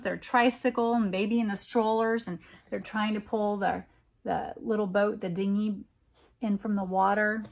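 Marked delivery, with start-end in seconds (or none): none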